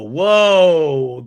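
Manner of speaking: loud, drawn-out whoa; amazed reaction. Real, not acted. Recapper reacts to gloves coming off, loud amazed whoa. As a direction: react big, stretching WHOA.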